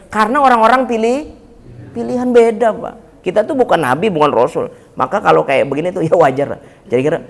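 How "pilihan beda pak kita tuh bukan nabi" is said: ordinary talk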